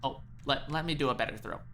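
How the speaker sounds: usual speaking voice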